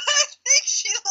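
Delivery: high and fast, breaking into laughter; gasping it out mid-laugh; laughing hard. Real, not acted. Friend has left the call, laughing helplessly.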